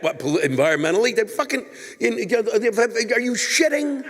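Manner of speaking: can't believe what he's hearing; incredulous. Real, not acted. Comic ranting at Tara's letter, disbelieving high squeak. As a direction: pitch jumps up in disbelief, fast then stretched.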